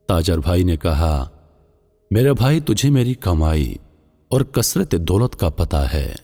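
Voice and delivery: plain reading voice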